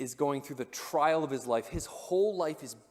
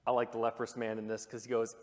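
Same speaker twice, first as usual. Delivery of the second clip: ordinary talk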